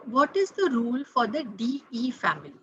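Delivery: ordinary talk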